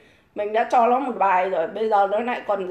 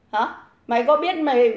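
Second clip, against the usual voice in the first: usual speaking voice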